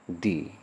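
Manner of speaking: "दी" with a long ee, like "thee". Shown as a demonstration. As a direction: stretch the vowel in "दी". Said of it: "क्या हो जाएगा". ("दी" लंबी ई के साथ)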